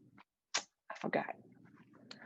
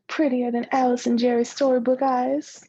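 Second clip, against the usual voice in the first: loud and bright, rising at the end; eager boast; 4 semitones higher, 8 dB louder than usual. Acted. Girl boasting to her friend, loud and eager. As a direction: announce it proudly, louder.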